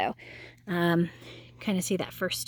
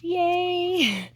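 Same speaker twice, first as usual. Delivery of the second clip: excited cheer; 10 semitones higher than usual. Real, not acted. Cheering on spotting carrots.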